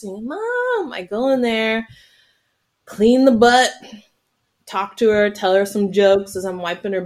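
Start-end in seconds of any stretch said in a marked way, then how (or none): 0.0-0.9 put-on high child's call. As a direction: call out like a toddler. Toddler calling from toilet.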